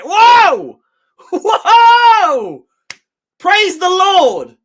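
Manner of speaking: loud, high drawn-out whoas; amazed cheering. Real, not acted. Fan stunned that the referee gave a free kick, loud amazement. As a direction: cry out in happy disbelief. Referee finally gives the foul.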